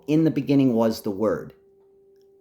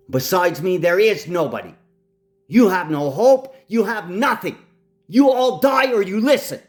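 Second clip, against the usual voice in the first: much higher, louder furious shouting; angry shout; 7 semitones higher, 4 dB louder than usual. Real, not acted. Ranting man shouts threats at the world, furious. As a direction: bellow it as a final threat. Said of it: "I will not raise my voice again".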